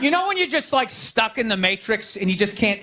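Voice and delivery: ordinary delivery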